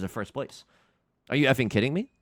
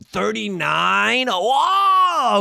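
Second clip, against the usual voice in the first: drawn out then a loud high whoa; stunned gasp at the number; disbelieving yelp; shocked; 9 semitones higher, 8 dB louder, much slower than usual. Real, not acted. Shocked at score reveal.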